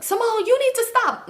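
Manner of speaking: high-pitched mock grandma; scolding grandma. Grandma walking in on the fight, high mock scolding. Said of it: "My grandma end up coming up in there".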